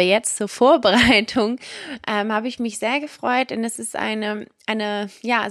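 ordinary talk, nothing marked

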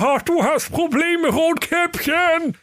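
very high whimpering octopus voice. Acted. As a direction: whimper it out, stretched slow. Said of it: "wimmerte der Tintenfisch".

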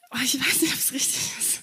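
real whisper, unsure, to herself; a hesitant whisper, half to herself; whispered aside. Real, not acted. Unsure aside mid-sermon. (unsicher geflüstert, zu sich selbst)

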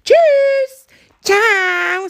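high, drawn-out farewell; called goodbye. Acted. Animals part at the zoo, calling high goodbyes. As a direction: sing out a long bright-pitched bye. Zebra and hare call goodbye.

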